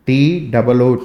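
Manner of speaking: ordinary talk, nothing marked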